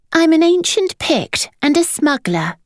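put-on high boy's announcement; proud boy. A narrator's reading. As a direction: declare it proudly like a child. Marmaduke announces himself.

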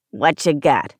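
low smoker's growl; curt, low-pitched pawnbroker rasp; two-packs-a-day growl. A narrator's reading. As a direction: growl like a chain-smoking pawnbroker. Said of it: "two packs a day camel's voice".

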